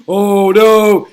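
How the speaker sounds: gasp, then loud high "oh no"; hammed-up horrified gasp and cry; mock fear. Cardinals fearing for their jobs, loud mock alarm. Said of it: "in fear for their jobs".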